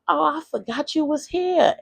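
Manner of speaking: high, airy put-on mother voice; mother's laughing excuse. Mother returning to child left home, amused and careless. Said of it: "And she's laughing".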